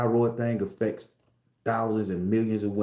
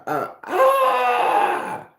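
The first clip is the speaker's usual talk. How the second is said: long, very high comic scream; panicked scream; 6 dB louder than usual. Acted. Man spots a fairy on his shelf, screaming. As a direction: scream "ah!" loud and drawn out.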